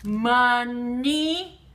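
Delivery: "Money" drawn out as mun, nee; sounding out. Shown as a demonstration. Teacher showing the middle sound, "Money" split in two. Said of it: "the na is in the middle".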